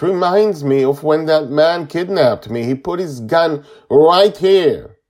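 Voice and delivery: put-on high, loud, tearful child's voice; crying hard. A narrator's reading. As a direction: wail out the frightening memory. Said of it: "he cries even harder".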